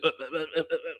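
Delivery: soft, repeated stuttered uhs; mock speechless stammer. Woman caught out over being late, mock stammering. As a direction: imitate someone lost for words. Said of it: "She didn't know what to say".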